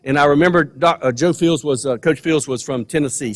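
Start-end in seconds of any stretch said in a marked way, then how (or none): none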